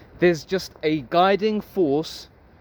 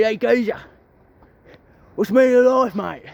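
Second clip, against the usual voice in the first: higher, louder hammed-up Cockney; Cockney geezer; 5 semitones higher, 4 dB louder than usual. Geezer coming up to Mister Socrates, hammed-up Cockney accent. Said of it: "a geezer with a Cockney accent".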